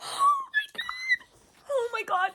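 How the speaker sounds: very high, whimpering squeal; overwhelmed squeak. Real, not acted. Reader swooning over the hero's line, high squeal. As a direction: squeak it out high, half crying.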